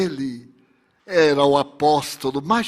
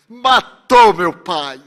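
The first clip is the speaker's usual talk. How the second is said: loud, drawn-out accusation; anguished accusation; 2 semitones higher, 9 dB louder, slower than usual. Acted. Victim accusing the Excellency of killing her father, anguished and loud. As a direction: hurl it slowly and loudly at the killer.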